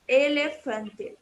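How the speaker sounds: Elefante said slowly and clearly, each syllable for learners to repeat; slow teacher model of Elefante; model word slowly. Shown as a demonstration. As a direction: Elefante spelled out in sound, slow and even. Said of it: "Se vocês quiserem repetir".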